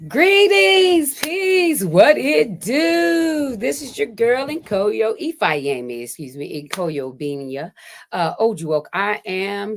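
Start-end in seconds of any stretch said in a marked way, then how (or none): 0.1-5.1 excited greeting. Real, not acted. Host opens the live show.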